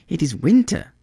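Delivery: high little bird voice; a small bird explaining it must fly south; high, gentle bird; swallow. Acted. Swallow answers.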